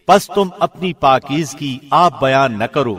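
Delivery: ordinary talk, nothing marked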